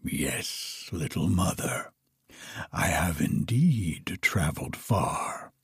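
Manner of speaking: low, hushed dragon voice; lightly voiced low dragon. A narrator's reading. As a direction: answer like a weary dragon, low and quiet. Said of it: "answered he".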